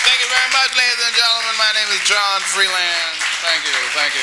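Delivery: loud, booming emcee; loud showbiz sign-off; announcer. Acted. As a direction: boom it out to the crowd. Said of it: "mock stage sign-off".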